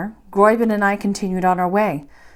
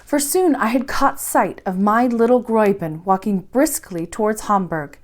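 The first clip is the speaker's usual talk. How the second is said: plain reading voice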